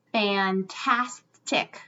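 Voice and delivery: fantastic stretched slowly, fan-tas-tic; slow syllable split. Shown as a demonstration. Teacher slowly chunking the word for kids.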